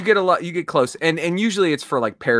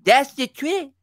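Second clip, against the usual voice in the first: higher cartoon boy voice, chin pushed forward; Cartman voice demo; 5 semitones higher than usual. Shown as a demonstration. Showing friends how to do Cartman. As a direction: push the chin out and talk like a brat. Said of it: "you just talk like this".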